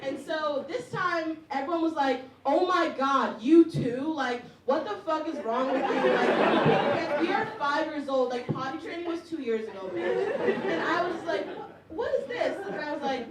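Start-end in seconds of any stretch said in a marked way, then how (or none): 2.5-10.3 louder jeering disbelief; five-year-olds taunting a classmate, loud; loud mocking classmates. 11.9-12.6 high stunned squeak. Retold or imitated.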